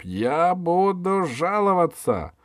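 высокая протяжная возмущённая угроза; пригрози жалобой, растягивая слова; злой протест. A narrator's reading. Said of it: "зашипел епископ". (высоко, протяжно, с возмущением)

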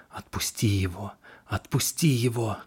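ghostly hiss, rising on the repeat; sinister whisper. A narrator's reading. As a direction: whisper it like a haunting. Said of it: "зловещий шёпот".

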